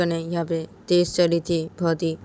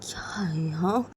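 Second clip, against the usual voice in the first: high, whispered sleepless grumbling; hushed annoyed self-talk; frustrated whisper; 9 semitones higher, 4 dB quieter than usual. A narrator's reading. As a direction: fume quietly in bed, unable to sleep. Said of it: "Ritu अपने बोलती".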